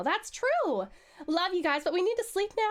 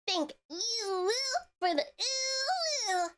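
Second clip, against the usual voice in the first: high, cooing thanks; cooing delight; 8 semitones higher, much slower than usual. Real, not acted. Streamer thanking a viewer for a care package, cooing. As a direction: coo with delight at the gift.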